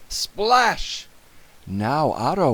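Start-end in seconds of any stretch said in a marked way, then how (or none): 0.1-1.1 high, sudden exclamation; dramatic splash. A narrator's reading. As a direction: let the splash burst out. Narrator voices a splash.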